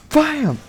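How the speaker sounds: high-pitched squealed bam; impact noise. Acted. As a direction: squeal out the crash. Child hits the cage.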